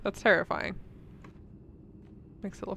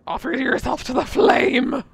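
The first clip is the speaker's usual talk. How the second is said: dramatic proclamation; 4 semitones higher, 16 dB louder than usual. Pretend. Dramatic in-game command.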